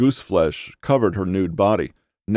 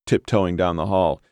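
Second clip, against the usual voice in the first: ordinary delivery, nothing marked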